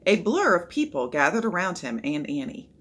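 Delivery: ordinary delivery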